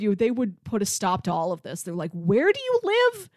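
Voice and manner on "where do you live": usual speaking voice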